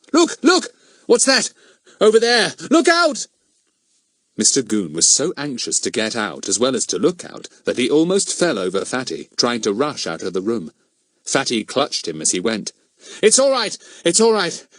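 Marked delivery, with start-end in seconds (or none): 0.1-3.3 high, loud alarmed shout. A narrator's reading. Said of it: "He suddenly gave a shout". 13.2-14.7 high, loud hurried reassurance; excited. A narrator's reading. Fatty reassuring after fake scare.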